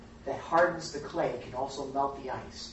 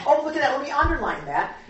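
high-pitched mock fascination; mock casual reader; 8 semitones higher, 5 dB louder, faster than usual. Teacher imitates himself marking his Bible, mock casual. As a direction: coo over a trivial find, hammed-up.

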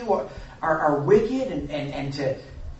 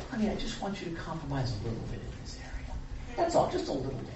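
coaxing Satan; 2 semitones lower, 8 dB quieter, faster than usual. Retold or imitated. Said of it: "He'll say".

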